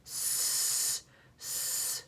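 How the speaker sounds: soft long hissed S sounds; hint the word with a quiet hiss. Shown as a demonstration. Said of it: "another word that has S at the beginning".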